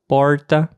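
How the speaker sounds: porta with a curled-tongue r, as in English "port"; "porta" with the r held on a curled tongue; regional R. Shown as a demonstration. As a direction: say "porta" with a retroflex caipira r. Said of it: "pronunciada de um jeito diferente".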